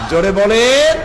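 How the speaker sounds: loud, rising call to the crowd; rousing call. Real, not acted. Preacher urges crowd to answer loudly.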